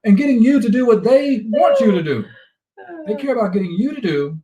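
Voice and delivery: loud, high, heated emphasis; worked up, at full tilt; fired up. Real, not acted. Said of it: "He's fired up today".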